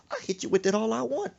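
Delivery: loud, high, quick retort; defiant angry mother. Retold or imitated. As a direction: shoot back that you're in charge. Mother defies son mid-fight.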